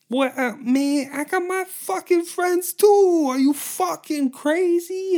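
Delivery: high, loud squeaky creature voice, slowed; shrill cartoon monster squaring up; mustard monster. Mustard monster threatening the dudes in a high, loud squeaky voice. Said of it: "the mustard monster's like".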